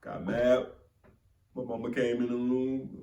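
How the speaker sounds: ordinary talk, nothing marked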